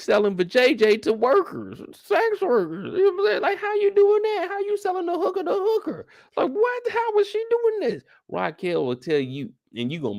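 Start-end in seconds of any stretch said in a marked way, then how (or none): 2.0-7.8 incredulous. Real, not acted. Incredulous high-pitched questions.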